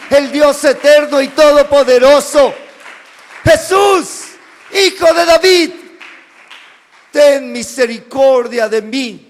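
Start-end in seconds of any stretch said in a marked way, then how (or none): 3.4-9.2 desperate cry. Acted. Blind beggar crying out to Jesus, desperate loud shout. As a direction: cry out to a passing king for mercy. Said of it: "Bartimeo empieza a clamar".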